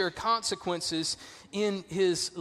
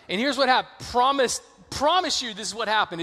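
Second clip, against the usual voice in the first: ordinary talk, nothing marked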